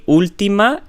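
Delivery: "última" with the first syllable ú lifted high; "última" stressed on ú, pitch jumping up. Shown as a demonstration. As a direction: lean on the ú of "última". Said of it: "porque tienen un acento aquí".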